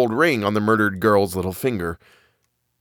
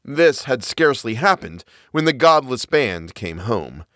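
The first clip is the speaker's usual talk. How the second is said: plain reading voice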